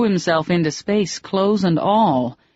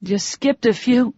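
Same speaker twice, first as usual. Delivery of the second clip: gasped; 7 semitones higher than usual. A narrator's reading. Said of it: "she gasped".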